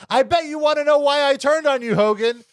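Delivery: high, loud mocking [name] voice; [name] impression. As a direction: ham up the sleazy manager. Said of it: "And he says".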